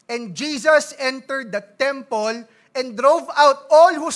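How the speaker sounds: slow, loud, weighty Bible reading; scripture reading. Acted. Pastor reading Matthew to his church, slow and loud. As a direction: proclaim the verses slowly to the congregation.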